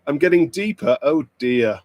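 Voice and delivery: ordinary talk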